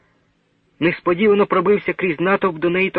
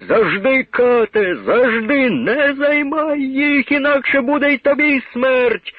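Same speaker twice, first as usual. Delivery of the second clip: high raised angry shouting; furious threat; 10 semitones higher, slower than usual. Acted. Crowd threatening the executioner, high angry shout. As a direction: hurl the threat at the hangman.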